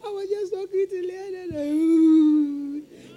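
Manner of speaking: high, loud mock sobbing plea; crying boy. Classmate pleading to the teacher, mock crying. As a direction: whimper it like a caught boy. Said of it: "he started crying".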